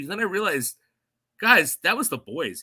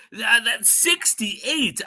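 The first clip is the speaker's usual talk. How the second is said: drawn-out, higher disbelief; slow; 7 semitones higher, much slower than usual. Real, not acted. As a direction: stretch the number out in awe. Marvelling at the number.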